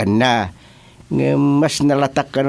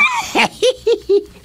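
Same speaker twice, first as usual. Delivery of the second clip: big high burst of laughter. Acted. Joke about old age.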